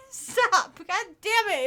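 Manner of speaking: breaking into giggles while reading; can't hold back the laughter; laughing. Real, not acted. Cracking up mid-letter.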